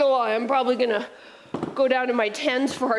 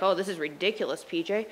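mock complaint; 6 semitones lower, 6 dB quieter, faster than usual.

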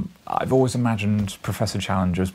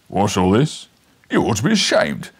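loud gruff constable; stern policeman; 6 dB louder than usual. Acted. Policeman breaking up the fight, loud and stern. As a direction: scold them like a bobby.

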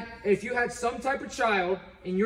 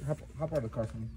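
quieter, grave lecture tone; low serious talk; 9 semitones lower, 8 dB quieter, faster than usual. Acted. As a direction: lower your voice, get serious. Cop turns low and serious.

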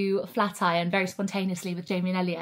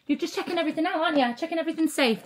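higher, sing-song pet voice; talking to the dog; 7 semitones higher, much faster than usual. Real, not acted. Speaking to Rupert the dog in a higher doting voice. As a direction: coo at the dog fondly.

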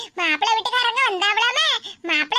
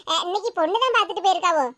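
ordinary talk

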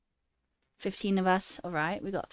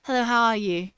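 a louder, playful British greeting; mock British accent; 2 semitones higher, 5 dB louder than usual. Streamer pretending to greet the CEO, mock British accent. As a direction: put on a light British accent. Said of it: "with a British accent".

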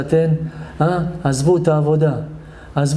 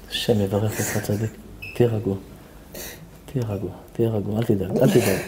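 low, soft reassurance; soothing; 4 semitones lower, 7 dB quieter than usual. Real, not acted. Rabbi tells crying man to be calm, soothing. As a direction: settle him down gently.

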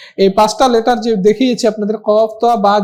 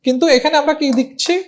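usual speaking voice

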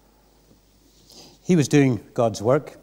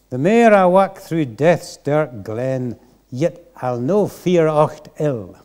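slow Scots verse, rolled and careful; old Scots reading; 3 semitones higher, 4 dB louder, slower than usual. Shown as a demonstration. Preacher reading the old Scots psalm to the congregation, slow Scots accent. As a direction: read it in broad old Scots, slowly. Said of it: "I will try and see if I can get my tongue around this".

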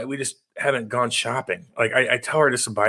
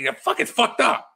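much higher mocking bystander voice; 10 semitones higher than usual. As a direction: imitate a flustered bystander. Mimicking bystanders.

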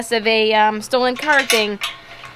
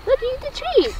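dog talk; 12 semitones higher than usual. Real, not acted. Coaxing dog with treats.

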